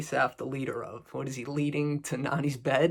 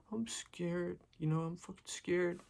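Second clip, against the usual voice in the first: higher, whispered mock fear; mock scared kid; 4 semitones higher, 7 dB quieter than usual. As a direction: imitate a frightened kid in the principal's office. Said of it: "he's like".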